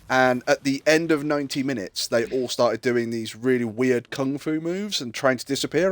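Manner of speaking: ordinary talk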